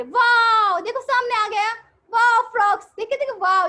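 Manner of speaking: loud, high amazed wow; excited wonder. Pretend. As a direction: pretend you just spotted it. Teacher pretending to see a frog.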